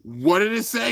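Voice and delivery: ordinary talk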